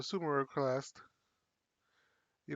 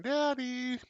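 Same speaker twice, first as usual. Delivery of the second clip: put-on kid calling daddy; 9 semitones higher than usual. Kids calling out coming home.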